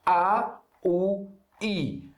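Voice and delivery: A, U, I ditos alto e separados; vowel demo. Shown as a demonstration. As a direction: mostre as três vogais, uma a uma. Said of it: "É com fatha, com damme, com kasra". (A, U, I bem marcados)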